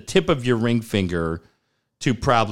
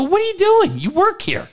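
hammed-up shock that the staff member got lightheaded; incredulous. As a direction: squeak out how ridiculous that is. Mock disbelief at anesthetist.

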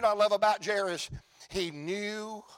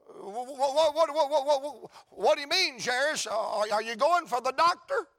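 higher, flustered stammer of confusion; stammering puzzled bystander; 5 semitones higher than usual. Acted. Bystander stammers at Jairus's answer, baffled and higher. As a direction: trip over the words in disbelief.

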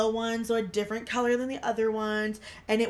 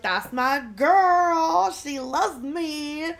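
mock pride; 7 semitones higher, 7 dB louder, much slower than usual. Mock proud aside.

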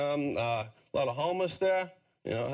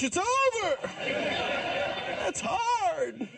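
mock shout; much slower than usual. Mocking a teen dropout.

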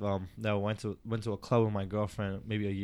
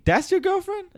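mocking challenge; 7 dB louder than usual. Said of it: "then he's asking me".

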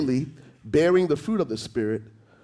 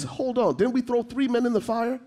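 astonished; 11 semitones higher than usual. Acted. Quoting astonished Nebuchadnezzar.

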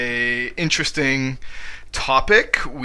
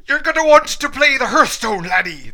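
much higher, louder mock Irish lilt; mock Irish accent; 10 semitones higher, 7 dB louder than usual. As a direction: ham up an Irish accent calling someone laddie. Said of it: "That was Irish".

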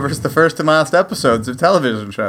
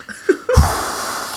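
high strained exhale; pained gasping sigh; 4 dB quieter than usual. Retold or imitated. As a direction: gasp for air after the hit. Winded after a hit.

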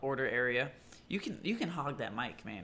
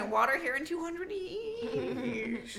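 high, slow dramatic announcer; mock announcer; 11 semitones higher, slower than usual. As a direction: announce it grandly. Mock narrator voice.

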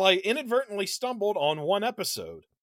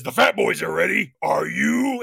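a lightly voiced Freddy Krueger, a bit louder; 5 dB louder than usual. TV ad Freddy taunting the viewer, mild menacing voice. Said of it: "He says".

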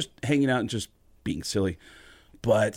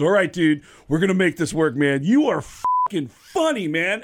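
high, loud, hyped-up approval; enthusiastic praise; 5 semitones higher, 5 dB louder than usual. Retold or imitated. Singer thrilled with the comedian.

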